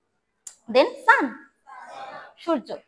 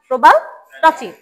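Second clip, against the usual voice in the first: ordinary delivery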